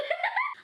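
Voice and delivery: helpless high-pitched laughter; high squealing laugh. Real, not acted. Laughing fit after blunder.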